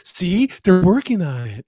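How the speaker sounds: high, loud, slow mock liberal voice; smug liberal pointing to progress, hammed up; ribbing liberals in a high loud Southern-free sneer. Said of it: "liberals are like".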